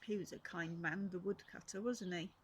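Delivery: ordinary delivery, nothing marked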